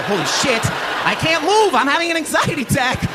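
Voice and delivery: high, panicky squeal; mock panic. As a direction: play the terrified kid frozen in place. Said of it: "I go".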